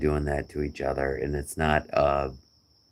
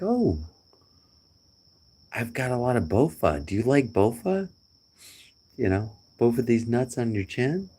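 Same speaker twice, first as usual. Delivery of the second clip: higher teasing voice, hamming the joke; mock silly voice; 7 semitones higher than usual. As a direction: play the friend's goofy line up high. Mocking a friend's joke.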